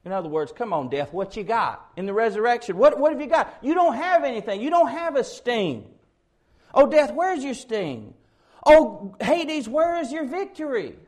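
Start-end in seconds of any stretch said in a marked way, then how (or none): 0.5-5.9 mocking dare in a raised preaching voice; taunting death. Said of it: "this is meant to be a taunt of death". 6.7-8.1 high, loud jeering challenge; taunting death. Acted. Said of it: "meant to be a taunt of death". 8.6-11.0 very high jeering cry; loud "Oh", then a high mocking challenge; taunting. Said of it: "saying that again as kind of a taunt".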